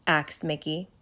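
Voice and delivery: ordinary delivery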